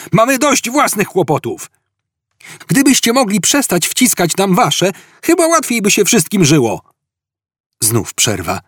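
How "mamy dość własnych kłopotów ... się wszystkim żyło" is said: high-pitched shouted complaint; yell. A narrator's reading. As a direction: holler your grievance across the hall. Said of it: "wrzasnął Ford". (wykrzyczana skarga, wysokim głosem)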